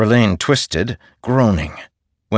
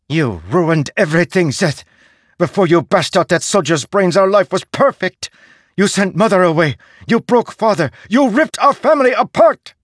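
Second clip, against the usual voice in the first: high, rising furious outburst; furious accusation; 11 semitones higher, 4 dB louder than usual. Acted. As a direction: accuse him with mounting fury.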